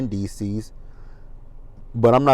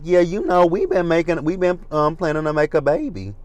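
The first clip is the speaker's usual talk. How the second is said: higher-pitched imitation of a friend; imitated friend; 7 semitones higher than usual. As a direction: lightly mimic her, pitched up. Voices friend announcing baby plans.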